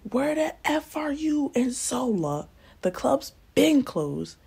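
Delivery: high, drawn-out mock angry yell; going off. Angry boyfriend on speakerphone demanding where they are, going off. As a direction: imitate an irate caller, stretching words out high. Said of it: "he is going off".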